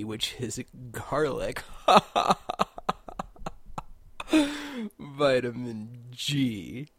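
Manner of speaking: slow, giggling delivery of the joke; laughing. Real, not acted. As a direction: crack up over your own pun.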